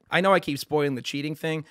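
ordinary delivery

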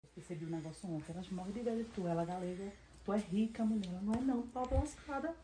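hushed, comforting a friend; low and soft, soothing her; soft reassuring. Acted. Comforting her sim friend, softly.

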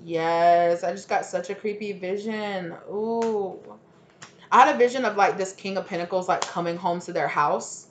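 ordinary talk

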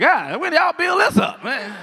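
high-pitched, hammed-up astonishment; amazed passerby. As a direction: voice shoots up in mock wonder.

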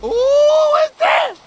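high, slow, loud shriek; startled shriek. Real, not acted. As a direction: cry out at a sudden shock. Sudden scare in game.

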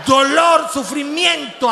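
ordinary delivery

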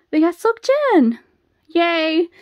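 loud, high celebratory shout; delighted cheer. Real, not acted. Fan announces [name] to viewers, delighted loud cheer.

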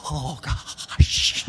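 put-on an awed kid, breathy and hushed; a kid stunned by an ice cream; breathless whispered awe. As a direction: gasp it out in wonder. Excited kid voiced in story.

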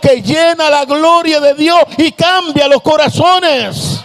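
rising, slowing fiery preaching that ends in a shout; preaching at full force. Acted. Preacher building to a shout before the congregation. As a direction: build the fire line by line until you shout.

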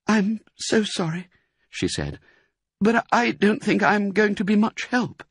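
high, gentle tired mother fox; apologetic, feeble vixen voice; weak Missus Fox. A narrator's reading. Weak mother fox to family, high apologetic voice.

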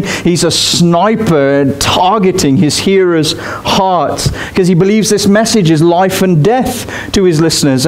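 ordinary delivery

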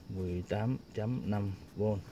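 slow and soft, word by word; slow dictation. Real, not acted. Vlogger noting the voltage as he writes it down, slow dictation. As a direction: say it softly, at writing speed. Reads out figure while writing it down.

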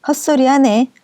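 understated narrated shout; mild mock yell. A narrator's reading. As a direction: call it out like a heckler, without really shouting. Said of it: "그녀가 큰 소리로".

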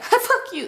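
high sneeze shaped into "fuck you"; words disguised as a sneeze; mock sneeze. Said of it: "It sound like that".

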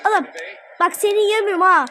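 ordinary talk

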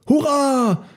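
high jubilant cheer. A narrator's reading. Herd sees Elmar colourful across the river, high cheering. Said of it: "jubelten die Elefanten".

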